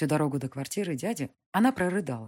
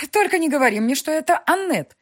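нервная усмешка; 13 semitones higher, 10 dB louder than usual. Acted. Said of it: "нервно усмехнулась мать".

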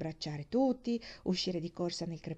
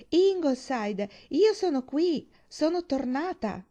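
high, loud, slow homecoming call; joyful announcement; 8 semitones higher, 7 dB louder, slower than usual. A narrator's reading. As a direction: announce your return loudly, drawing out the words. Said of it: "annunciò". (annuncio gridato, alto e lento)

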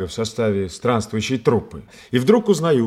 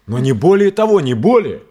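louder, pressing the point; emphatic denial; 5 semitones higher, 6 dB louder than usual. Acted. Actor insists to Basil it was only gratitude. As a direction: stamp down the denial.

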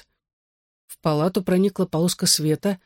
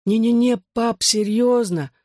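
higher, slowed woozy protest; unruly tongue; 6 semitones higher, slower than usual. A narrator's reading. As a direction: protest as if the tongue won't obey. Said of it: "Я ворочал непослушным языком". (заплетающимся языком, настойчиво)

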